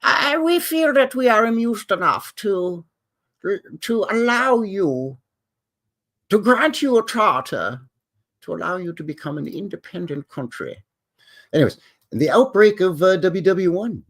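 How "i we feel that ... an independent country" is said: high, posh royal imitation; the Queen. Host imitates the Queen granting Canada a charter, high royal voice. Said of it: "And she says".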